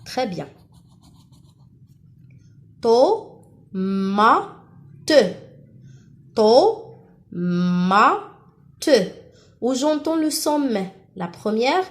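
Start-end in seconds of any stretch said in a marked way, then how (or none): 2.8-7.8 "Tomate" in three separate beats, to, mmma, te; syllable by syllable. Shown as a demonstration. Teacher asking pupils for the syllable, "Tomate" split slowly. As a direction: break "Tomate" up, humming the middle m. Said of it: "la deuxième ou bien la troisième".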